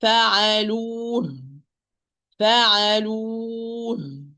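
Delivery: "فعلوه" held long, then released into a clear h; long vowel, then an airy ه on "فعلوه"; audible final ha. Shown as a demonstration. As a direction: stop on "فعلوه" with breath sounding the last ه. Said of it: "If I'm going to stop".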